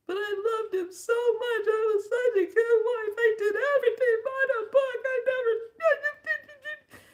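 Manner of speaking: high, loud crying wail; sobbing protest. Retold or imitated. To the elder, sobbing over the husband she loved. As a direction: cry out how unfair it was.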